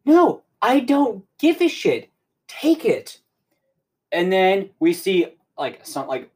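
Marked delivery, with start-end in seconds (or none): 0.0-3.2 much higher, slow, emphatic mock refusal; exaggerated high-pitched insistence; Mandalorian. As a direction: stretch each word high, imitating an exasperated warrior. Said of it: "Mandalorian's like".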